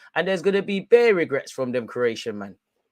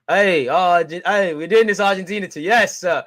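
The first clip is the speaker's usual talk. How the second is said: excited call-out; 4 semitones higher, 7 dB louder than usual. Real, not acted. Fan joining Argentina's celebration, loud excited call. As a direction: shout it out to join the party.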